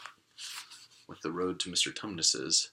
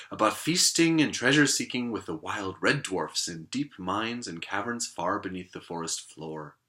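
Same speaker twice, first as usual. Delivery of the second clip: plain reading voice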